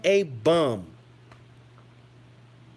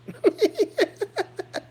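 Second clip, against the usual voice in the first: high, giggly laugh breaking out; real high-pitched laughing fit; high burst of laughter; 13 semitones higher than usual. Real, not acted. Laughs at own teammate list.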